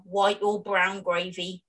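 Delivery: usual speaking voice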